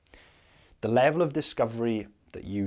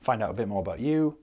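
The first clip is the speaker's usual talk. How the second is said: ordinary delivery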